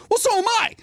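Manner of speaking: high female voice. Retold or imitated. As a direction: squeak it out as the woman. The woman's quoted reply.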